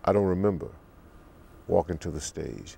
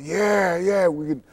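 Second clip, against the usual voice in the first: high, loud, excited crowd cheer; raised, punchy shouts of approval; crowd responding; 11 semitones higher, 5 dB louder, slower than usual. Retold or imitated. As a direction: call out like a thrilled concertgoer. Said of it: "they would respond".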